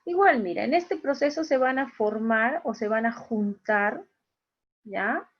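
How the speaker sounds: ordinary talk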